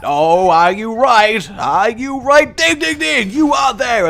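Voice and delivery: hammed-up quiz host revealing the answer; game show host. Host to contestant guessing prices, hammed-up hype.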